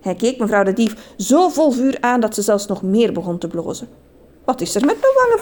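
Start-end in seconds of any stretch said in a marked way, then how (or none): 4.4-5.4 high-pitched, surprised query; startled storybook lady; puzzled question. A narrator's reading. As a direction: ask it with the voice jumping up. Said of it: "vroeg ze".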